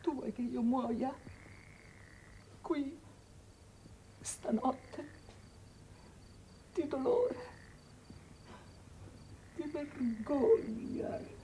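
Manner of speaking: acuto ma molto più piano, spezzato; dolore soffocato. Acted. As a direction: parla piano, ferita a morte. Madre disperata e ferita. (dolore soffocato)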